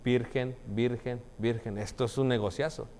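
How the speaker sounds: quietly plotting a big business; scheming whisper-like aside. Retold or imitated. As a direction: murmur it like a merchant smelling money.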